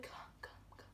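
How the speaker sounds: urgent whisper, no voice at all; hiss-whisper them over to safety; whispered call. Retold or imitated. Adults beckoning her across during the shooting, whispering. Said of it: "They were like".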